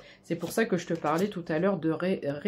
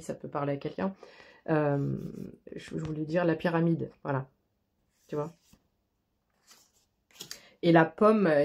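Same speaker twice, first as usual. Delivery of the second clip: ordinary talk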